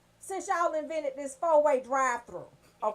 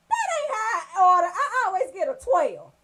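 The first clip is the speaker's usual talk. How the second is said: indignant outburst; 4 semitones higher, 8 dB louder than usual. Real, not acted. Indignant protest.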